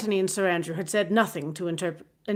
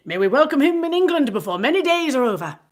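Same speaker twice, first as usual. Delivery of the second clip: merry; 6 semitones higher, 6 dB louder than usual. A narrator's reading. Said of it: "said Lord Antony merrily".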